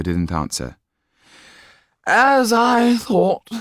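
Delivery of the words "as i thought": high, loud bleary demand; a drunk father not knowing his daughter; drunken confusion. A narrator's reading. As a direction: peer at her drunk and baffled. Drunk marquis.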